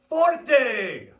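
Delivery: high, forceful shout; loud, punched demonstration; forte, strong sound. Shown as a demonstration. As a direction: call it out big and strong. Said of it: "Good, again".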